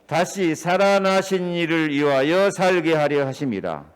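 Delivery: loud, preached scripture reading. Acted. Pastor reading the verse aloud to his church, proclaiming.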